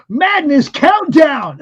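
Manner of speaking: loud, slow show announcement; big announcement. Pretend. Host unveiling the changed game, big announcement. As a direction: draw it out like an event title.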